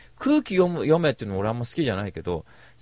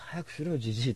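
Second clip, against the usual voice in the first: put-on muttered softer, so the old man won't hear; under the breath; 9 dB quieter than usual. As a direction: grumble it quietly to the girls, half joking. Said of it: "おじさんに聞こえないぐらいの声で".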